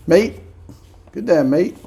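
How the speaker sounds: high, loud Australian greeting; mock Australian accent. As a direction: throw out a cheery Australian mate. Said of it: "I know that's Australian".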